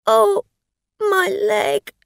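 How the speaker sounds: high, loud tearful wail of pain; wail, then catch your breath in a sob; starting to cry. A narrator's reading. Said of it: "She began to cry".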